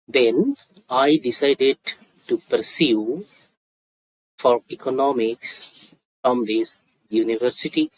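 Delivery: very slow, with gaps between phrases; slow demonstration. Shown as a demonstration. Teacher models how a student reached university, slowly. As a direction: spell out the answer slowly, phrase by phrase. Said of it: "আস্তে আস্তে বলছি".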